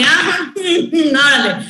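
laughing, slow ah órale with a pitch lift; stretched, laughing ah órale; surprised órale. Retold or imitated. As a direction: caught off guard and amused. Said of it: "entonces como de".

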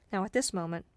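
ordinary delivery

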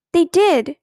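loud, insistent protest; a child's stubborn insistence; insisting. A narrator's reading. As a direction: plant your feet and insist. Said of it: "Ramona insisted".

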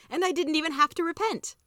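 high-pitched gleeful boast; pleased as can be; delighted. A narrator's reading. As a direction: crow about it, voice lifted high. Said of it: "he said delightedly".

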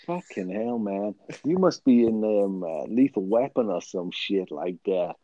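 mock American action-movie talk; American accent. Podcaster threatening co-host like an action hero, mock American accent. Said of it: "let's try and talk American".

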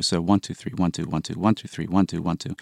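counting one, two, three, one, two in groups of three and two; counting seven eight. Shown as a demonstration. Composer demonstrating a seven eight count to the host. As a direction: count out the beat groups steadily. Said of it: "a group of three and then a group of two".

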